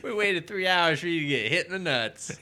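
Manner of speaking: loud mock frat guy. The friends gloating loudly about the kick. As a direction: boast it like a dumb bro. Voicing the guys, laughing.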